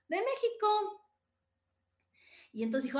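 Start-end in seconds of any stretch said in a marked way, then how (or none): 0.1-1.1 high, slow "De México"; proud, drawn-out reply to the salesman; proud answer. Retold or imitated. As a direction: stretch out "México" proudly, pitched well up.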